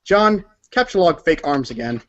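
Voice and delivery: ordinary talk, nothing marked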